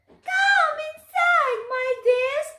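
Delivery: very high, slow, sugary crone voice; sweet old witch. Acted. Witch inviting the children inside, falsely sweet. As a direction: lure them in like a sly old witch.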